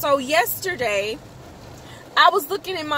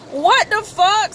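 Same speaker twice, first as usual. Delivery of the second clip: raised, louder what the fuck; retold shock; 6 semitones higher, 4 dB louder than usual. Seeing three wives in his contacts, outraged disbelief. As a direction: hit it with outrage.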